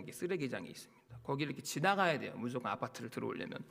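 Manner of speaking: normal talking voice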